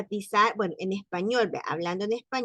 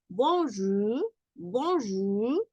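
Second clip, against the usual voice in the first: French bonjour; much slower than usual. Shown as a demonstration. Teacher modelling French bonjour for Spanish speakers.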